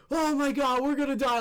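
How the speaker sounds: high mock-panicked cry; retold fright. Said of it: "scared or whatever".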